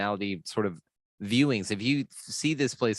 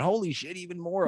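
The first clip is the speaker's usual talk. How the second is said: pitched up high in stunned awe; even more amazed; 8 semitones higher than usual. Retold or imitated. Recalling a bigger epiphany, higher amazed gasp. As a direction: let the wonder climb higher.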